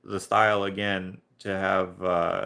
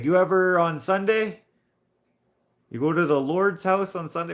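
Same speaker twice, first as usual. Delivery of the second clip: high, louder mock French accent; 9 semitones higher, 5 dB louder than usual. Coworker asks about Sunday church, imitated French accent. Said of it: "but I am French".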